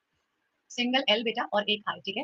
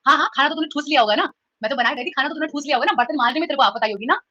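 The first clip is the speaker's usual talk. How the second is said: louder, sarcastic taunting mother; provoking taunt; 3 semitones higher, 4 dB louder, faster than usual. Acted. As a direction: needle them to get a reply. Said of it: "उकसा उकसा के ना बात पूछना". (ताना मारती माँ, ऊँची आवाज़ में)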